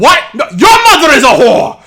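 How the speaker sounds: high, very loud indignant scream; screaming back. Acted. Yaki turning back on the jeering kids, screaming. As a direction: turn and hurl an insult at the crowd. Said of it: "like, screaming back at them".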